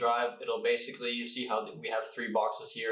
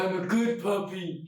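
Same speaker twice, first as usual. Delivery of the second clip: high, louder puppy voice; cartoon dog; 9 semitones higher, 4 dB louder than usual. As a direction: speak as the happy puppy. Voicing the dog.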